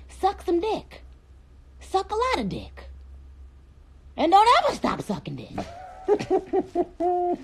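high, put-on playful voice; mock-sweet high voice. Streamer joking after a sincere blessing, high put-on voice. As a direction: pitch it way up for the crude punchline.

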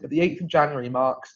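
"marks" said plainly in the present tense; slip of tense. Real, not acted. Host misreads the anniversary date, saying marks in plain voice. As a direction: read it as "marks", the slip before the fix. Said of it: "not marks".

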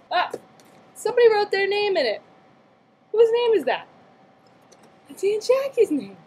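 high, fast burst of surprise; sudden amazed discovery; surprised. Real, not acted. Finds a name written in book.

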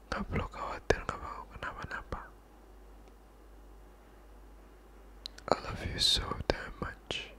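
intimate comforting whisper, close to the mic; hushed boyfriend whisper; soft whisper. Acted. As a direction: whisper reassurance to your girlfriend.

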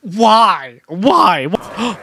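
loud, high cry of disbelief; high, exasperated outcry; anguished why. Real, not acted. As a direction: wail it at the game. Anguish at the game's result.

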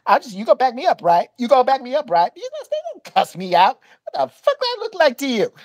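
imitated high-pitched complaint, pressing for agreement; indignant clerk. Clerk on phone call with the manager, mock indignant. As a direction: act out her worked-up phone rant, pitched well up. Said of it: "And she's like".

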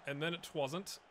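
usual speaking voice

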